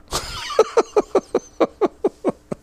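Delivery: a real laugh breaking up high. Real, not acted. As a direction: laugh at the punchline. Laughs at his own story.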